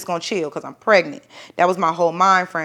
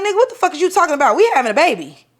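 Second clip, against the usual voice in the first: fast, high, loud disbelieving retort; retelling her outburst at him, higher and louder; shocked outrage; 9 semitones higher, 4 dB louder, faster than usual. Retold or imitated. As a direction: fire it back, stunned and furious. Retorting to divorce demand in car.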